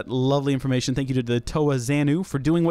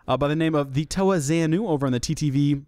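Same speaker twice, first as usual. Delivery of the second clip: normal talking voice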